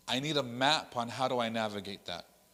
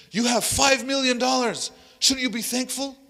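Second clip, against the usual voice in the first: mock-triumphant exhortation; insistent preacher; 11 semitones higher, 8 dB louder than usual. Retold preacher pressing his argument.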